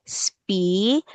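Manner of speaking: "species" split into two long syllables, spe-cies. Shown as a demonstration. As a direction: draw out each half of "species" for a learner. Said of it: "Okay, one more time. Let's say".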